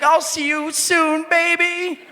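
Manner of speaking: high, slow heroic cry; mock-epic farewell shout; hammed-up movie martyr. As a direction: draw it out like a dying hero. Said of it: "And he was like".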